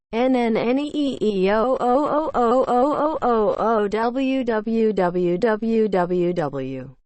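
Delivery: drawn-out howling plane whine; airplane noise chant. Pretend. Chanting protesters imitating airplane noise.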